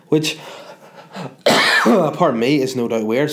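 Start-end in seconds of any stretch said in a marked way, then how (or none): 1.1-1.8 hard dry cough from a cold; coughing fit. Real, not acted. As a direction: cough it out, mid-sentence. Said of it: "Sorry, I'm coughing so much".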